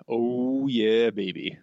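a light, not-so-low attempt at a smooth crooner; half-hearted stab at a velvet soul purr. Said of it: "my voice is not low enough".

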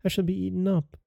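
high, soft, scared wail; frightened. A narrator's reading. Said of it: "she cried".

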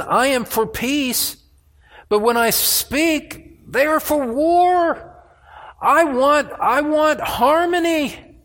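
high, slow, pleading cry; psalmist's plea. Acted. Preacher voicing the psalmist, high pleading cry. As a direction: reach up high and plead for peace. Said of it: "He says, verse seven".

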